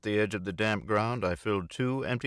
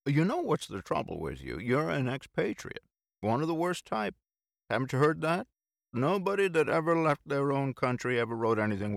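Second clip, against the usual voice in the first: mock lecture; 7 semitones higher than usual. A narrator's reading. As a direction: lecture him in jest. Bill holding forth.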